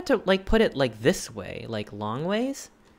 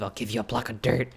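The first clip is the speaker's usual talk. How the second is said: quoting a meme voice; 4 semitones lower, faster than usual. Retold or imitated. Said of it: "can't say block of dirt without laughing".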